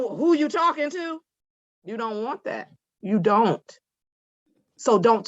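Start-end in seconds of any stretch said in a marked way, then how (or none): 0.0-1.2 high-pitched mock street challenge; confrontational back-talk. Shown as a demonstration. Judge demonstrates to defendant talking on her level, confrontational. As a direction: pitch it way up, imitating someone squaring up. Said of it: "if I start talking like this".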